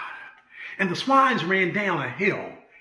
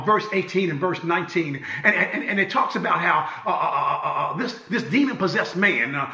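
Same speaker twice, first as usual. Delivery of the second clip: ordinary talk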